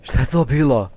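loud startled question; shaken shout. Real, not acted. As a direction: still shaking from the fright. Right after jump scare.

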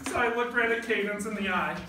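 usual speaking voice

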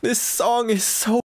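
gushing high. Real, not acted. Moved by the ending song.

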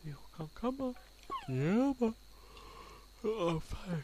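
high, loud call to the wolf; high coaxing call. Real, not acted. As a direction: coax the wolf up, then swear. Calling the wolf.

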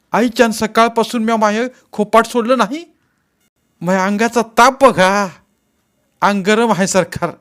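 high, loud protest of innocence; desperate pleading suspect. A narrator's reading. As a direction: beg the officer to believe you.

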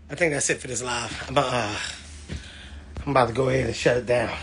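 winded, quiet after running; out of breath. Real, not acted. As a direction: talk while catching your breath. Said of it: "I'm out of breath from when I ran outside".

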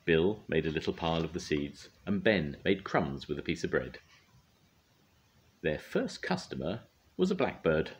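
ordinary delivery, nothing marked

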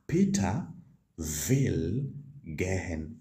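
slow, careful German model line; slow model sentence. Shown as a demonstration. Teacher slowly modelling the German sentence for students. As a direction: say will gehen slowly for learners. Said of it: "You simply say".

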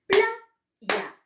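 Playa split, PLA high and loud; stress on first syllable. Shown as a demonstration. Teacher showing the tonic syllable, Playa with a loud, high PLA. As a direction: hit PLA hard in Playa, then drop on YA. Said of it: "tenía mayor fuerza de voz".

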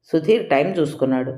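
usual speaking voice

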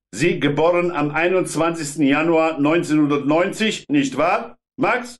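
put-on high, hard Polish-accented inspector. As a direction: bark it in rough Polish-accented German.

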